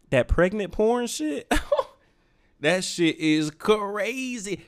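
slow, laughing through the words; can't hold back the laugh. Real, not acted. Laughing at his own joke.

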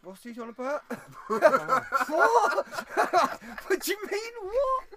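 high, loud laughing what; laughing disbelief. Real, not acted. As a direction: crack up in disbelief.

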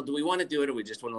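ordinary delivery

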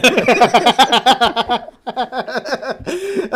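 high cackling laugh; laughter. Real, not acted. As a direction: crack up in a long run of laughs. Cracking up at own joke.

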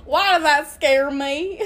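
teasing Southern echo, stretched out; imitated Southern accent. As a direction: mimic the friend's Southern twang. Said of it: "You sound like a Southern".

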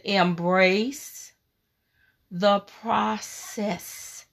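Embrace the process drawn out slowly, the process trailing soft; slow, soft God's voice. Retold or imitated. God answers her again, slow and gentle. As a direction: stretch every word, gentle and unhurried.

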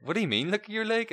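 high-pitched incredulous question; retold disbelief, pitched right up; baffled. Friend asked to look at a leg, baffled.

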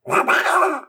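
high squawking duck noise; mock duck quack; Donald Duck squawk. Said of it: "I'm about to do a Donald Duck impression".

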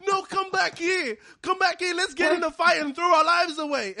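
high, loud mock call after him; mock pleading call. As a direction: call him back for the fight.

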